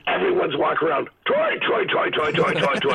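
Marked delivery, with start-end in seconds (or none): 1.3-2.9 hammed-up descending Irish lilt; Irish accent. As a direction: mimic everyone chanting in a lilting brogue. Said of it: "do an Irish accent in five seconds".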